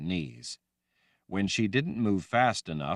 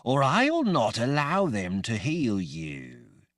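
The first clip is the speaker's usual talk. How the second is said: slow, cold threat, half her own; terrible voice; 5 semitones higher, 3 dB louder than usual. A narrator's reading. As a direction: threaten as a possessor. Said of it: "in that terrible voice only half her own".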